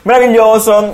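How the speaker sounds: high, laughing delight. Real, not acted. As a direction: say it through laughter. Laughing after Minecraft joke.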